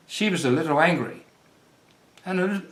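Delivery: usual speaking voice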